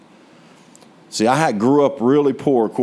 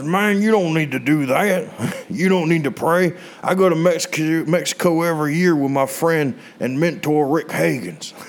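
put-on friend Joey's twangy country accent, pitched up; 5 semitones higher than usual. Preacher imitating his friend Joey in a country accent. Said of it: "a very country accent".

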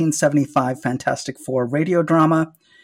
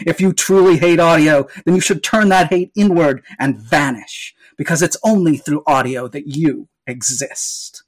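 usual speaking voice

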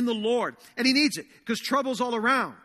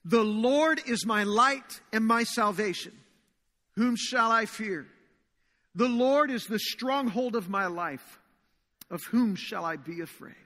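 slow, solemn congregational reading; measured psalm recitation; reading scripture aloud; slower than usual. Acted. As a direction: read each line out slowly, declaring it. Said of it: "Let's read this together out loud".

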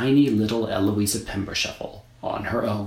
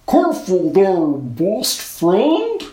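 loud, higher snowman tease; teasing snowman; 9 semitones higher, 8 dB louder, much slower than usual. A narrator's reading.

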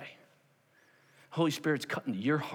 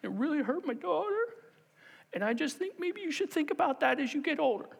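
high, tearful mimicry of a hurt mother; mock crying voice, pitched way up; imitated tearful woman; 13 semitones higher than usual. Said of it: "And she starts, and I'm like".

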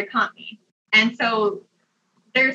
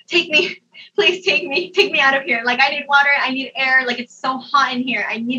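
higher-pitched, anxious begging, retold; acted urgent pleading; 4 semitones higher than usual. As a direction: plead to be taken away, pitched high.

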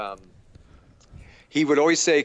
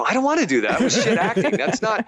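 laughing protest; 9 semitones higher than usual. Retold or imitated. Retelling his refusal to director.

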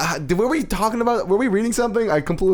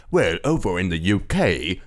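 low, soft mock British accent; soft fake-British voice; 7 semitones lower than usual. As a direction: imitate a softly spoken British gent. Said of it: "it was so soft and like".

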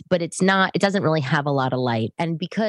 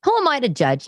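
mock judgy disbelief; 11 semitones higher, 4 dB louder than usual. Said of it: "And I was like".

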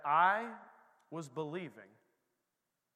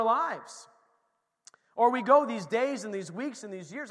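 louder, rising preacher's drive; preaching; 5 semitones higher, 8 dB louder than usual. Acted. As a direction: press the point home to the church. Preaches to the congregation.

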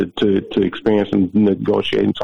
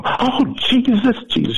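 high, drawn-out mock church wail; retold ecstatic cry, slow and very high; Holy Ghost meltdown. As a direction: imitate a woman overcome with praise. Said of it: "She went into a Holy Ghost meltdown".

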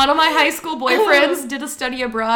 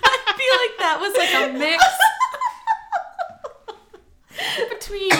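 high voice breaking into laughter; squeaky, halting laughing speech; crying with laughter; 12 semitones higher than usual. Real, not acted. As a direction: struggle to talk through tears of laughter. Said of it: "Oh, [name]'s crying".

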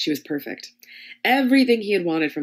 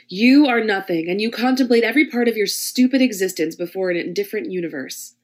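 pin him down with each word; commanding dominatrix; 4 semitones higher, 7 dB louder than usual. Acted. Dominatrix degrades Hamlet.

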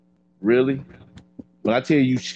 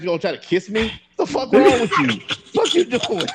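retold outrage jumping much higher in pitch; shocked protest; 12 semitones higher than usual. Man retelling his refusal of a kiss, high shocked protest. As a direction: act out a high-pitched, startled objection.

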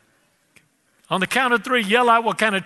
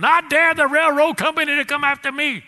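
high, squeaky little ant voice; tiny defiant ant; 8 semitones higher, 3 dB louder than usual. Retold or imitated. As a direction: pipe up like a cocky ant.

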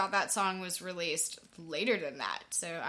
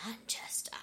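whisper; 4 semitones higher, 12 dB quieter than usual. Pretend. Echoing co-host's word as joke.

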